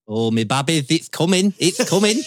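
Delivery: high, loud caricature wail; impression voice. Podcast guest performing his requested impression, high and excitable. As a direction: climb up high and loud, calling to me babbies. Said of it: "now is the time for your impression".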